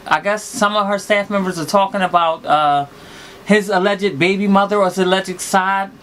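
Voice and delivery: ordinary delivery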